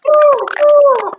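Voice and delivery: put-on high, loud cooing baby noise; mock baby coo. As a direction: squeal it like a tiny baby bird. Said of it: "she's like".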